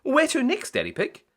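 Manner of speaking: high loud perky pilot voice; Miss Rabbit. A narrator's reading. Miss Rabbit ready in her helicopter, high and loud. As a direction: ask it brightly as Miss Rabbit. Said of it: "asked Miss Rabbit".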